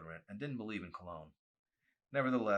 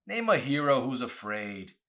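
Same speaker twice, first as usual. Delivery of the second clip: loud, high, scornful sneer; 7 semitones higher, 13 dB louder than usual. Acted. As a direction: throw the challenge out with open contempt. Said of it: "he heard him sneer".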